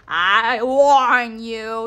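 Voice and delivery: loud, slow, drawn-out menace; cruel threat. A narrator's reading. Dwarf threatening Henwen with a hot coal, cruelly. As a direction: stretch the warning out cruelly. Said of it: "he said cruelly".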